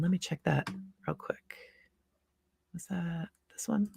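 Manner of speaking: softly whispered to herself; whispered aside. Real, not acted. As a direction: whisper it aside while checking. Aside while checking.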